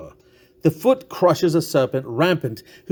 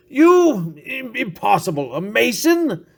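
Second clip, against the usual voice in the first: incredulous disbelief; 6 semitones higher, slower than usual. Acted. Tipsy friend scoffs at his host's claim, incredulous. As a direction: scoff in disbelief that he could be one. Friend doubts narrator is a Mason.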